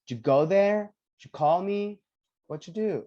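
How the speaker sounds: "go", "call" and "do" stressed, "Did you" shrunk to "ju"; main verb stressed. Shown as a demonstration. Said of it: "has the stress or the emphasis".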